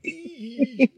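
high squeak of disgust; disgusted squeal. Retold or imitated. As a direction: squeak like touching something gross. Imitating Andrea's squeal at doors.

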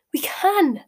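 high, loud burst of excitement; excited. A narrator's reading. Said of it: "said Ron excitedly".

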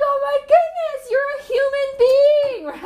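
high, delighted mock gasp; delighted squeal. Telling her calm friend she is human, delighted high squeal. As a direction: squeal it like a happy surprise. Own delighted reaction quoted.

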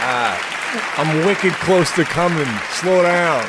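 high, loud mock South Boston accent; urgent joke bedroom voice, Boston accent. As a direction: ham up a frantic Boston lover. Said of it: "doing the South Boston accent".

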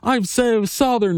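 high, gushing fake-Southern brag; mock a politician pretending to be a local; mocking Dan Patrick. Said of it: "And he's, he's like".

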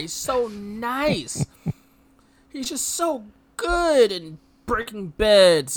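slow, drawn-out mock gushing; sarcastic praise. Real, not acted. Mocking the movie hero to co-host, slow sarcastic gushing. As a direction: gush sarcastically about him.

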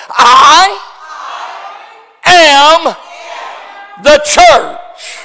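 high, loud shout, word by word; big pulpit shout with pauses between words; forceful call-out. Shown as a demonstration. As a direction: belt it out for the congregation to echo. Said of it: "that's kind of weak and puny. Let's try it over".

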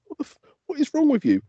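mock a parent losing patience with a wailing child; exasperated parent. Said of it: "I was like".

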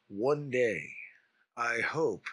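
low, slow, soft announcer voice; radio announcer. Acted. Hopeful bindle stiff stating his goal, low announcer voice. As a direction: intone it like a radio announcer, pausing between phrases. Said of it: "'cause you speak like a radio announcer".

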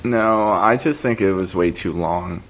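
usual speaking voice

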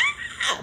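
high, voiceless burst of laughter; demonstrated laugh. Shown as a demonstration. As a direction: let out a sudden squeal of a laugh. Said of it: "like, a laugh".

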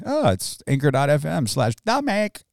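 mock stuffy sick voice; imitating a sick cohost. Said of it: "I was trying to find your sick voice".

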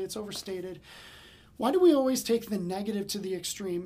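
ordinary delivery

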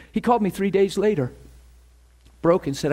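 ordinary talk

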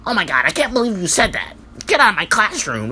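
high, shocked schoolteacher voice, well above his own; mocking the fussy teacher in a squeaky high voice; mocking prissy teacher. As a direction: mimic an uptight teacher clutching pearls. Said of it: "they always wanna be on some".

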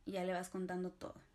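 ordinary delivery

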